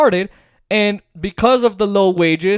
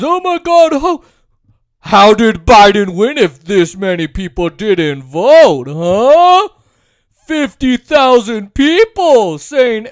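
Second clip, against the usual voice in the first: mocking dad; 2 semitones higher, 5 dB louder, slower than usual. Son mocking his Republican dad, loud and slow. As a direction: ham up a right-wing dad's disbelief. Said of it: "That's my way of mocking him and them".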